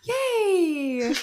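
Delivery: high, drawn-out happy cheer; excited cheer. Real, not acted. Friend hears he's coming today, excited cheer. Cheering news of his visit.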